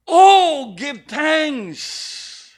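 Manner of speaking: preacher's exclamation on Oh; emphatic Oh. Shown as a demonstration. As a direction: hit the Oh hard before give thanks. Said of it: "that one letter word there emphasize".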